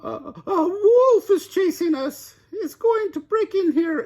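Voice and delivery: very high, loud panicked squeal; frightened piglet. A narrator's reading. Elmer fleeing to Pete's house, panicked and squealing. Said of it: "Elmer cried".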